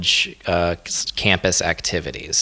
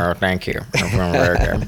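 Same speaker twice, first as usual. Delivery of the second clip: lightly put-on impression of a friend; Delman. Showing off a praised impression, mimicking a friend. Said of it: "You do a very good Delman impression".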